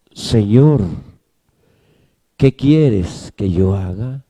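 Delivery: hushed, low and slow fearful question; low, timid plea; trembling, fearful. Acted. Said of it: "Él temblando y temeroso dijo".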